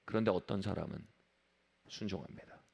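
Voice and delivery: low, very soft sermon turn; quiet, low and reverent; hushed. Real, not acted. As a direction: let the voice fall almost to nothing. Obedience set against loud resistance.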